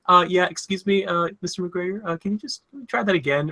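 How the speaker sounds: high, hushed and hesitant polite request; mock a nervous crew member interrupting; timid crew voice. Crew asking Ewan McGregor to redo the take, timid and hushed. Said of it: "And then they're like".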